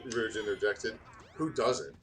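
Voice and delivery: ordinary talk, nothing marked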